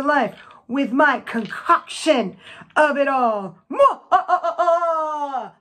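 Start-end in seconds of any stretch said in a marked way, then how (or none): 3.7-4.0 smacking kiss. Acted. Witchy kiss after concoction. 4.1-5.5 witch cackle. Acted. Witch cackle over her brew.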